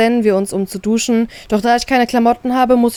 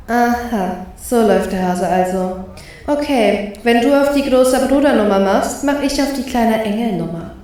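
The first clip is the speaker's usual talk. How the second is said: unimpressed. Acted. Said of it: "Gleichgültig starre ich ihn an".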